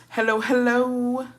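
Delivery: high, slow "hello, hello"; sing-song greeting. Acted. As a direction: stretch the hellos out in a high lilt.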